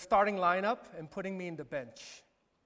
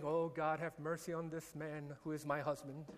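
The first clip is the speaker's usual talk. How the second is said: quiet prayer; 4 semitones lower, 10 dB quieter than usual. Retold or imitated. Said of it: "ever patient, ever gentle, ever praying".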